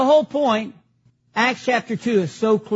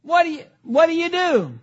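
higher-pitched baffled challenge; incredulous reaction; 4 semitones higher than usual. Retold or imitated. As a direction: act out your own bewilderment. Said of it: "I'm like going".

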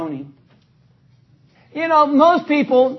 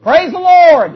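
"Praise the Lord!" called out loud; shouted praise, higher and louder; yelled praise; 5 semitones higher, 7 dB louder, slower than usual. Shown as a demonstration. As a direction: yell it out to the congregation. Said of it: "yell out".

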